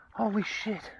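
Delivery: usual speaking voice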